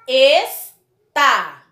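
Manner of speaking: "Está" as Es... tá, loud and detached; slow, forceful syllable break in "Está"; split into syllables. Shown as a demonstration. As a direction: pause between the two syllables of "Está". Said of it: "Ó a separação".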